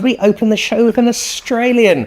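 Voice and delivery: high, incredulous tease; mock outrage. Host teasing his Australian guest, mock outrage. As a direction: pretend horror at the guest.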